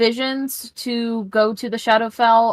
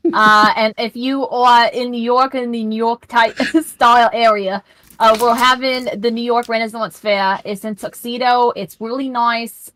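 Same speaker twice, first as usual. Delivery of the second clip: a hammed-up New York local plugging the fair; New York accent. Player plugging the Renaissance Fair in a New York accent. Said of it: "my New York accent".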